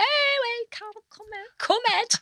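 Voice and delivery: high, excited fan calling Carol over. Retold or imitated. Fans at a charity do calling Carol over, high and thrilled. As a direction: squeal her name like a delighted fan. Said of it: "everyone's going".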